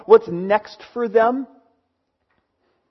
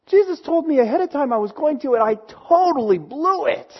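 high-pitched, hammed-up lament; mock wailing regret; 7 semitones higher than usual. Peter lamenting that he blew it, wailing. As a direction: whine it in despair at yourself.

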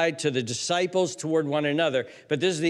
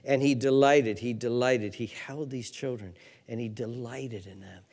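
tender hush; 5 semitones lower, 4 dB quieter than usual. Real, not acted. Preacher softly telling how Jesus held the children. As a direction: bring them in close, gently.

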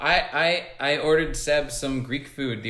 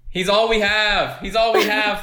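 mock outrage; 9 semitones higher than usual. Mock-defending his Greek brother.

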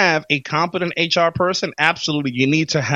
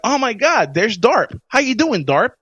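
higher, bright mock surprise at spotting Darp; excited greeting; 7 semitones higher than usual. Coworkers spotting Darp in the cafeteria, excited mock greeting. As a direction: call out to him like an old friend.

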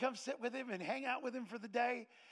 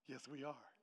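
low, very soft reply; quiet confirmation; 7 semitones lower, 11 dB quieter, slower than usual. Retold or imitated. Husband answers his wife about the church, hushed. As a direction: confirm it gently under the voice.

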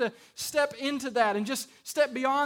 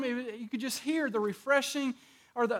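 ordinary talk, nothing marked

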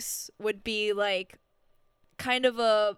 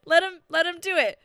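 laughing; 10 semitones higher, 4 dB louder, faster than usual. Real, not acted. Laughing reply to Alan Tudyk.

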